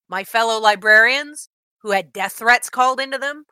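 ordinary delivery

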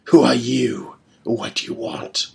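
hissed, unvoiced questions; menacing whisper; whispered demand. A narrator's reading. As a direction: whisper it dangerously. Falkir demands answers.

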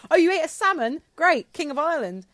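loud, high mock-pompous announcement; mock king's proclamation. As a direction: proclaim it like a silly herald. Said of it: "They all just end with".

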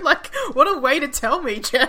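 loud, high, teasing outburst; laughing indignation. Real, not acted. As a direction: scold your sister through laughter. Teasing sister over scare.

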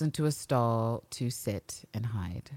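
slow, hushed whisper. Real, not acted. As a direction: whisper as if hiding in a stall. Narrating hiding scene.

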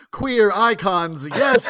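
loud, drawn-out queer cheer; excited cheer. Retold or imitated. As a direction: shout it out with glee. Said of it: "I'm like".

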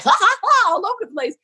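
squeaky high mimicry of a carefree mom; a lightly laughing, airy imitation of a loose mom; high mock laughing mom. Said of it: "mom would be just like".

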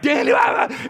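sudden high, loud cry; startled shout. Retold or imitated. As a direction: yelp his name in surprise. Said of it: "And I'm like".

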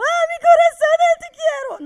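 very high, loud, slow Spanish gush; gushing relative. Retold or imitated. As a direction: overflow with affection. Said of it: "dès que c'est genre". (effusion hispanique très aiguë et forte)

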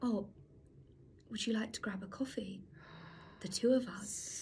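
soft, whispery mock suitor; tentative invitation. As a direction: ask shyly under your breath. Shy stranger asking mum out.

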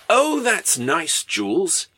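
high, drawn-out compliment; mock admiring. Wells comes along and sees Jules's airship, mock admiring. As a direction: coo it slowly and high, as a comic character.

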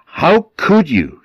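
raging sister scolds the mother; furious scolding. Acted. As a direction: she is enraged at her mother. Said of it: "Tatterhood was furious".

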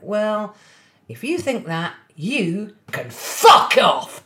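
angry outburst. Retold or imitated. Nephew angrily telling his aunt off after losing the quiz. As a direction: imitate a furious nephew lashing out. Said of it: "he reared back and his face changed".

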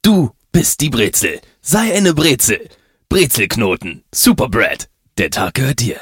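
loud, punchy commercial slogans. Acted. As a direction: sell it like a TV ad. Ad slogan read.

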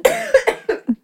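high sudden choking cough. Real, not acted. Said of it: "Geht's dir gut".

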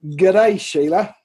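weak stab at an Australian accent; barely Australian, close to your own accent; Australian accent attempt. Shown as a demonstration. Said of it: "do you wanna hear my Australian accent".

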